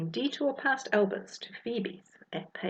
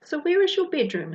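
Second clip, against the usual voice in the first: high, fast eager question; 9 semitones higher, 5 dB louder, faster than usual. Acted. Ophelia eager to get to the monster task, fast and high. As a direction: rushes to get started.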